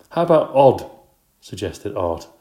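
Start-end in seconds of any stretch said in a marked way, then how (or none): 0.1-0.9 loud, high suggestion; called out. Acted. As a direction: call it out to the teacher. Art calls out suggestion.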